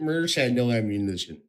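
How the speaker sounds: ordinary talk, nothing marked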